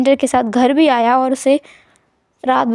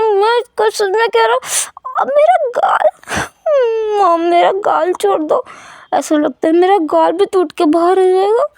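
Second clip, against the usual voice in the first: whining in pain; 6 semitones higher than usual. Acted. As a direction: beg mom to let go of his cheek. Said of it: "गालों को भी खींचते हुए".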